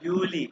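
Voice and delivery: यूली as "YOO-lee"; German यूली ending in a long ee; corrected pronunciation. Shown as a demonstration. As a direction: correct the student with यूली, y at the start. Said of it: "हाँ, हाँ, हाँ".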